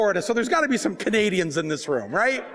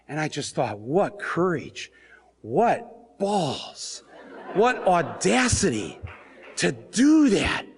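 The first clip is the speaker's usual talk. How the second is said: normal talking voice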